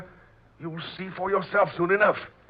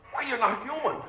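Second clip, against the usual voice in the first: higher, startled cry of dread; horrified gasp; 8 semitones higher than usual. Acted. Sailor realising the intruder is a skeleton, horrified. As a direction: it dawns on you what this is.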